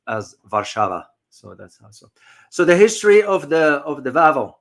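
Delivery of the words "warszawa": Warszawa said "Var-SHA-va"; Polish pronunciation. Shown as a demonstration. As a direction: give Warszawa its Polish V sounds. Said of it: "if in Polish pronunciation".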